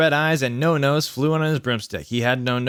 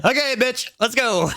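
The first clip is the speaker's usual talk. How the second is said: very high comic squeak; high silly voice; 11 semitones higher than usual. Acted. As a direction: redo it absurdly high for laughs. Comic redo of the sad line.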